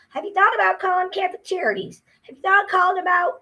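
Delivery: high, fast mocking singsong; mocking the helpers. Mocking the agencies' advice in a high fast voice. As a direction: parrot them in a high fast voice. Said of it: "It's like".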